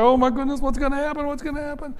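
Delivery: high, mock-panicked fretting; worked-up panic. Imitating a worried believer all worked up, high mock panic. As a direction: fret in a mock panic, pitch way up. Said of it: "We oftentimes get all worked up".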